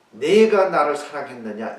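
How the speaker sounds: loud, high reproachful question; rebuke. Acted. Jesus rebuking Peter for the past, loud reproach. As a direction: scold him for the past, hard and loud. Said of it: "이것은 책망입니다".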